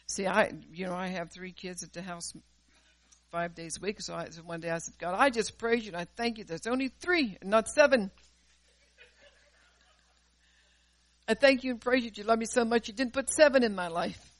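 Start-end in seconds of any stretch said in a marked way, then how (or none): 5.0-14.3 excited thanks. Retold or imitated. Mock prayer of thanks retold.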